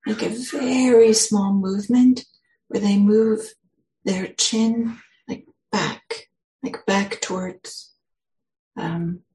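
unhurried guidance with long pauses; guided meditation. Acted. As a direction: ease listeners into stillness, phrase by phrase.

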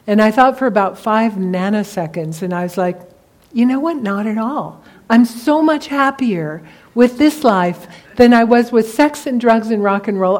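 ordinary talk, nothing marked

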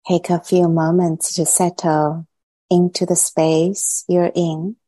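unhurried meditation guide, long silences between phrases; guided meditation. Acted. Teacher easing meditators gradually into body, mind and heart, guided meditation. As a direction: guide them into settling, phrase by phrase.

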